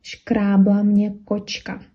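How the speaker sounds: teacher modelling a Czech phrase; slow example phrase. Shown as a demonstration. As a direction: spell out «Шкрабла мне кочка» word by word for learners. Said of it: "можете сказать".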